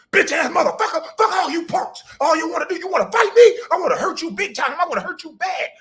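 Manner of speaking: hammed-up angry cursing; mock furious rant. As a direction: scream threats like the brawling streamer. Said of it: "he brawling, he cursing".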